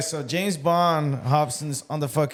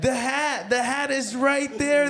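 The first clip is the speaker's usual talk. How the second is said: retold calling out; 8 semitones higher than usual. Friends yelling at the rider.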